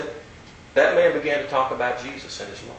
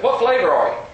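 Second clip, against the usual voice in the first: put-on high, eager old gentleman's question; old man's voice; 8 semitones higher, 6 dB louder than usual. Old stranger quizzing the preacher, high and eager. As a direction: chirp it up high like the curious old man. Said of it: "this is how he said".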